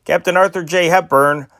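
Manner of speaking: ordinary talk, nothing marked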